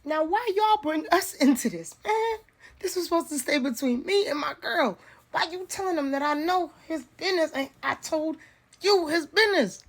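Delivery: high, indignant mock voice of the dude; imitating the dude. Mocking the dude who got called out, high indignant whine. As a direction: pitch it way up, offended and whiny. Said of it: "The dude said".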